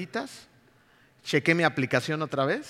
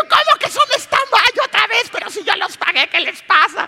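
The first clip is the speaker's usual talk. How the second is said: outraged disbelief; 9 dB louder than usual. Retold or imitated. Traveller outraged at airline staff, high indignant cry. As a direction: explode in disbelief.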